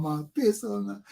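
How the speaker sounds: ordinary delivery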